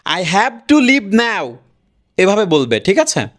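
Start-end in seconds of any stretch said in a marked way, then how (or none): 0.1-1.5 exaggerated Indian accent, slow and higher. Shown as a demonstration. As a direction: put on a strong Indian English accent. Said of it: "Indian accent এটা". (Indian অ্যাকসেন্টে টেনে টেনে)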